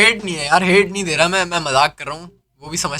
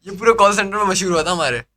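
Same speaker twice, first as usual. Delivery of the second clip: normal talking voice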